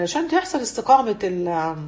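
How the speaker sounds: ordinary talk, nothing marked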